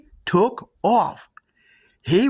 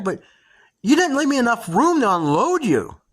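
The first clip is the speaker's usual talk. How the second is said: usual speaking voice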